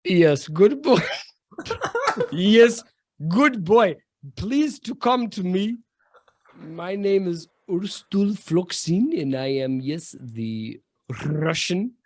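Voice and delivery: put-on high, hammy Russian accent; thick fantasy Russian accent. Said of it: "with a very thick Russian accent".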